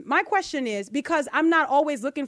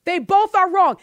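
higher and much louder, "both" hammered hard; emphatic repeat; 5 semitones higher, 8 dB louder than usual. Real, not acted.

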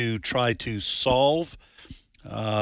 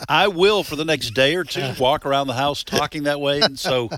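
normal talking voice

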